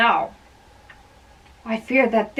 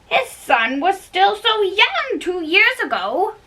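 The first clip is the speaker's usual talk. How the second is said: disbelief; 9 semitones higher than usual. Acted. Old man disbelieving the years.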